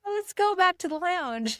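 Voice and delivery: high, whiny little plea; high-pitched quote. Retold or imitated. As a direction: pitch it way up, playfully pleading. Quoting herself wanting to leave.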